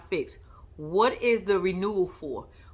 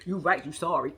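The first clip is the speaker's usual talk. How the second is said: mocking retort; 7 semitones lower than usual. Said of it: "I was saying in the back of my head".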